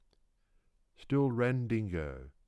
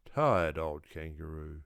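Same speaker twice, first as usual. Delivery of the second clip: plain reading voice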